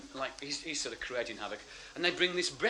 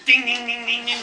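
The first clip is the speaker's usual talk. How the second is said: high, loud imitated clanging; imitation of a cup on cell bars; rattling cup noise; 9 semitones higher, 11 dB louder than usual. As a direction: rattle out dings like tin on bars. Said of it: "rattling his cup on the bars".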